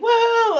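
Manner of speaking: high, loud whoop; mock kid whoop. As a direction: cheer like a kid on a piggyback. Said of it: "it's like".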